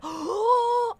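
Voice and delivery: sharp high gasp; delighted gasp. Real, not acted. Hearing what a chatter is eating, a big delighted gasp. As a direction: gasp as if it's the best news ever.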